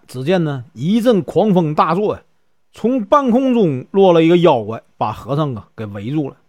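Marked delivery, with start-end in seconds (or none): none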